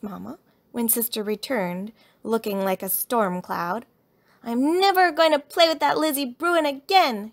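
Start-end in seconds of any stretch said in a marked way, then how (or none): 4.4-7.3 high, loud vow from a cross cub; indignant childish outburst; angry shout. A narrator's reading. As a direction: vent loudly to mama. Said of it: "shouted Sister".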